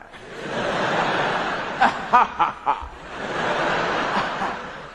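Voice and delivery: breathless unvoiced villain laugh; evil cackle. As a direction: wheeze out a gloating evil laugh. Evil giraffe gloats.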